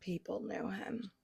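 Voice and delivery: slow, voiceless whisper; hushed echo. Real, not acted. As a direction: whisper it back with weight. Hushed echo of friend's reveal.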